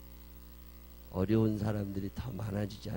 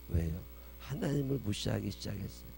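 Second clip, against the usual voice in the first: normal talking voice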